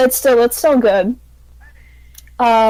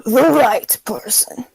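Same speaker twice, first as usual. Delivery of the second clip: put-on slow, cryptic old man; a hint of an old man; slower than usual. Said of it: "old person voice".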